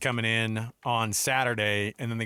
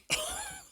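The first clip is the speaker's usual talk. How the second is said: high burst of laughter; 8 dB quieter than usual. Real, not acted. Teasing about Purdue picks.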